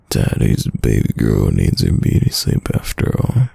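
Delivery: usual speaking voice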